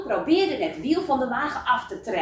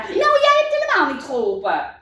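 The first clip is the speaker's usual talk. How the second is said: high, louder acted accusation; angry shout; 10 semitones higher, 6 dB louder than usual. A narrator's reading. Kas turning on Lucia for giggling, shouting. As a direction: yell it at her in a storybook squabble. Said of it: "schreeuwde Kas tegen haar".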